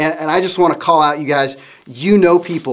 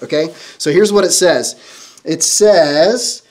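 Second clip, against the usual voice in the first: usual speaking voice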